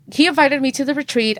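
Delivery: ordinary delivery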